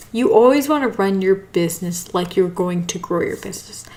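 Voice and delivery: usual speaking voice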